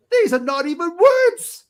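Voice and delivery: high, loud, slow disbelief; exasperated cry. Retold or imitated. Reader baffled by Glasgow dialect on the page, exasperated cry. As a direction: stretch it out in rising, baffled protest.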